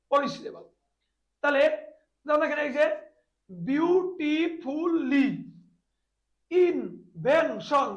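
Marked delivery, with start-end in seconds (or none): 3.5-5.3 beautifully said very slowly, split into four separate beats; beautifully drawn out as beau-ti-ful-ly; syllable by syllable. Shown as a demonstration. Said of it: "beautifully চার syllable". 6.5-7.9 invention said very slowly, split into three separate beats; invention drawn out as in-ven-tion; syllable by syllable. Shown as a demonstration. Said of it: "তিনবার".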